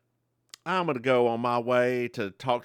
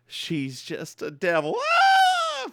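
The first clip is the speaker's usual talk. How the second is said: weak little cry; 4 dB louder, slower than usual. Retold or imitated. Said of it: "Carlene does a faint cry".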